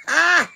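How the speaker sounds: sudden loud high "ah"; loud startled cry; loud yelp. Real, not acted. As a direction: burst out with a yelp. Sudden exclamation.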